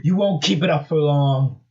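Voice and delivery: sneering villain. Acted. Eggman taunts the fighting heroes, sneering. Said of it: "sneered Eggman".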